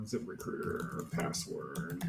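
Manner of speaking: slow, whispered mutter while typing; muttered to self. Real, not acted. Reading his screen while typing, whispered mutter. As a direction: breathe the words to yourself, slowly.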